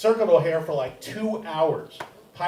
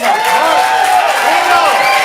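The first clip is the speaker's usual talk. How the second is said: big introduction yell to the room; announcer shout; 13 semitones higher, 16 dB louder than usual. Real, not acted. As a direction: call the name out loud and high to the back. Host calling up next comic.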